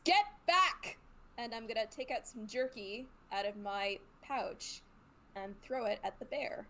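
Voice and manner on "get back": high, loud in-character yell; raised, urgent call; yelled warning. Acted. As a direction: shout a warning to a companion. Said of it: "I'm gonna yell at Stinksack".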